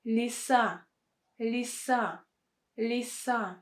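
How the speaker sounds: лиса with И in the unstressed first syllable. Shown as a demonstration. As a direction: model лиса, short И, stress on the final A. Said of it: "it's pronounced as И".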